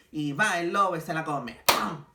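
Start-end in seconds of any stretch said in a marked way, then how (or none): 1.7-2.0 a louder sound-effect "pam" for the wolf's bite. Acted. Narrator marks the wolf gulping the grandma, loud bang. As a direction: slap the word out like a bang.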